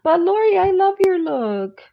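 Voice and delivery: high, loud, tearful-laughing praise; to Lori, laughing till tearful; laugh-crying. Real, not acted. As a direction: gush until the voice cracks. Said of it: "I'm already crying".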